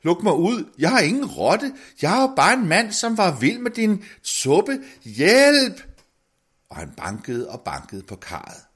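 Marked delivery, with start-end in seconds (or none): 0.0-0.7 "luk mig ud" råbt højt og lyst med tryk på "mig"; råb i panik, indespærret; panisk råb. A narrator's reading. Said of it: "råbte". 0.8-5.8 højt og højere, råbende i panik; panisk råb. A narrator's reading. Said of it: "råbte".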